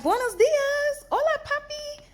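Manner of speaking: very high, loud, drawn-out gushing hello; excited greeting. Retold or imitated. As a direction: sing it out to the surgeon, thrilled. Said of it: "and I'm like".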